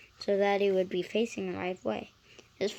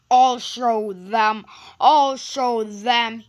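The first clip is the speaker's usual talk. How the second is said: slow, loud spiteful threat; hissed; 12 dB louder, slower than usual. Acted. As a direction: spit it out with spite. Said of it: "he hissed".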